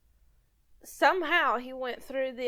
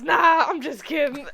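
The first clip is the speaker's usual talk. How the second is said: louder, laughing through the words; laughing tease; 3 semitones higher, 7 dB louder than usual. Retold or imitated. Retelling the father-in-law's prank, laughing as she quotes him. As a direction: chuckle as you confess the joke.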